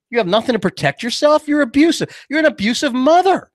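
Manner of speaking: high-pitched indignant rebuke; scolding. Acted. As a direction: lay into an imagined mother. Scolds imagined single mom.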